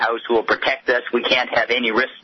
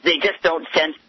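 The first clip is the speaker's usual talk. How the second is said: normal talking voice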